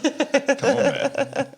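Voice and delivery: high, slow hammed-up complaint; mock Italian man. Winemaker protesting about France, hammed up. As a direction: drag it out like a fed-up Italian. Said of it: "playing the Italian winemaker".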